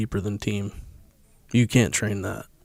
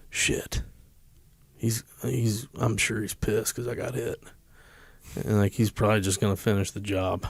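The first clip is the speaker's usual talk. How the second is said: quiet scared inner voice; hushed panic; 8 dB quieter than usual. Retold or imitated. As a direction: breathe it out, dreading. Terrified inner thought, hushed.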